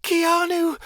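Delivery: very high, loud call; girl crying after departing Keanu. A narrator's reading. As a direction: call after him as he leaves. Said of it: "Chameleon cried".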